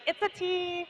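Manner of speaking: high, soft mock voice; imitating Kim. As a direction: mimic her in a high, quiet pitch. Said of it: "Kim's like".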